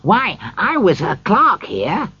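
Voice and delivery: high-pitched, eager exclamation; excited cry. A narrator's reading. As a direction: burst out high with recognition. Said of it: "cried Scrooge".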